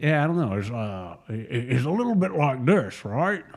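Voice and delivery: tentative, half-hearted Scottish actor impression; Sean Connery. Shown as a demonstration. Co-host prods him to try the impression, half-hearted Scottish actor voice. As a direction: have an unsure go at a famous Scottish actor's voice. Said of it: "you gotta do it like, uh, Sean Connery".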